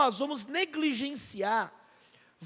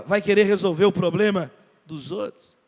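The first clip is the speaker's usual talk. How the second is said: ordinary delivery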